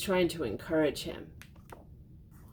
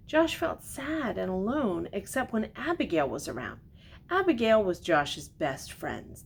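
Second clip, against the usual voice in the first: put-on gentle read-aloud to a child; storybook reading; 3 semitones higher than usual. A narrator's reading. Reads picture book aloud.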